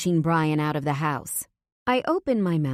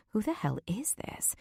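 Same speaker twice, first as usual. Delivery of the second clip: whisper; 5 semitones higher, 8 dB quieter than usual. A narrator's reading. Brian's panicked silent plea.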